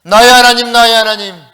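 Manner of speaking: very loud, anguished full-voice cry. Acted. Jesus on the cross calling to God, crying out. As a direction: cry out to heaven, stretching the last words. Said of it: "막 외치는데 대답이 없어".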